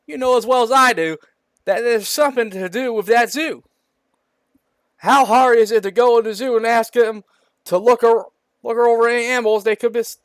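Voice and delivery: loud, high-pitched sheriff; hammed-up angry insistence. A narrator's reading. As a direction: argue heatedly as the sheriff. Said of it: "Shout out the sheriff of anger".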